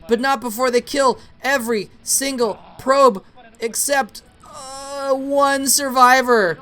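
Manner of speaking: high-pitched, urgent match commentary; excited play-by-play. Real, not acted. Caster calling vultures wiping out probes, excited play-by-play. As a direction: cry out the kills, stretching the final phrase. Live play-by-play of base kill.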